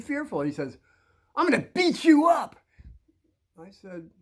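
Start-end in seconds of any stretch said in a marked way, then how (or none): 1.4-2.6 loud, high bully threat; intimidating threat. Retold or imitated. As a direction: imitate a bully threatening a smaller kid. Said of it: "This guy's trying to intimidate me".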